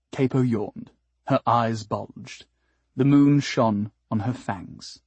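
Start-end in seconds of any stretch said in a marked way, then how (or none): none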